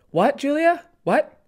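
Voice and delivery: high, incredulous snap; exasperated teacher. Retold or imitated. Teacher answers the rambling student, high and incredulous. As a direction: play the teacher losing patience. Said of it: "he was just like".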